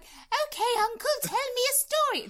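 put-on high squeaky little girl voice, loud; man doing a woman's voice. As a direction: squeal it like a young niece. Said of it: "That's your old lady voice".

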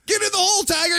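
loud, high-pitched golf crowd yell; screaming heckler. Retold or imitated. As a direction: holler it like an overexcited fan at the tee. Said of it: "And somebody's screaming".

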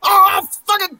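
high, loud, delirious whooping; overexcited shouting. Retold or imitated. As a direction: whoop like a hyped-up salesman. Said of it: "But when I was like".

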